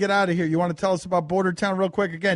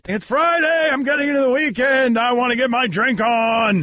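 excited; 6 semitones higher, 6 dB louder than usual. Real, not acted. Friday party hype intro.

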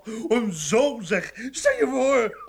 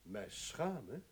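much softer, whispered contempt; bitter hush; 10 semitones lower, 10 dB quieter than usual. Acted. Husband telling his wife about their son, bitter whisper. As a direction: hiss the disgust low.

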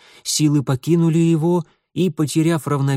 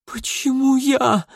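desperate cry; 12 semitones higher, slower than usual. Acted. Last breath before fainting.

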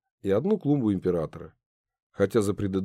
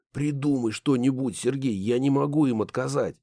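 hoarse pleading voice; 4 semitones higher than usual. Acted. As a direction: rasp it out, pleading. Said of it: "походил на хрип".

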